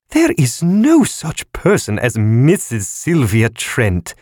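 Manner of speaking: high, slow, condemning; wrathful condemnation. A narrator's reading. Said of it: "with wrathful condemnation".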